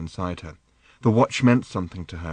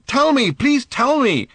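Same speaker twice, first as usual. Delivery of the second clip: pleading; 6 dB louder, slower than usual. Acted. Arthur pleading, panting.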